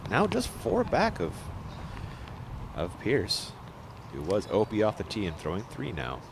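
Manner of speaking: usual speaking voice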